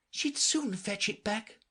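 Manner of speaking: put-on wistful girl. A narrator's reading. Alice wishes for her cat.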